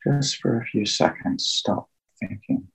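slow, hushed meditation guide with long pauses; guided meditation. Acted. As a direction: ease listeners into calm, phrase by phrase, leaving long silences.

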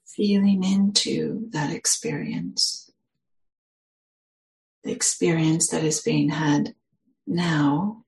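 slow, measured meditation guidance with long pauses; guided meditation. Real, not acted. Meditation teacher settling listeners into their posture, guided meditation. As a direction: guide listeners gently into the body, unhurried.

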